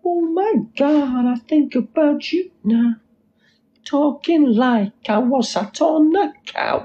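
very high, drawn-out mimicking voice; put-on character impression. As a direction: stretch each word up high in mimicry. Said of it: "That was a good impression".

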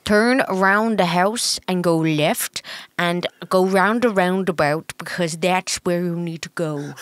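slow, muddled Irish with a Dutch tinge; botched Irish accent. Shown as a demonstration. Partner failing to copy host's accent, muddled Irish accent. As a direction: badly imitate an Irish accent. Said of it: "like, Dutch and".

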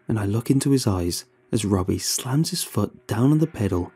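usual speaking voice

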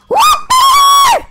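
very high, loud celebratory squeal; loud whoopee. Pretend. Player cheering in the game, very high loud whoop. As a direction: shriek it out in glee.

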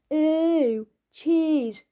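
high, loud disgusted "Ew, cheese"; mocking noise. Marcus taunting Tom over his pizza, loud and sneering. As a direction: sneer it out like a bully.

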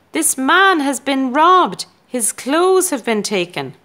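high, loud alarmed shout. A narrator's reading. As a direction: raise the alarm loudly. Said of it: "Cat was shouting".

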